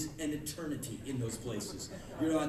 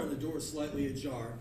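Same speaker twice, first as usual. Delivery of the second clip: normal talking voice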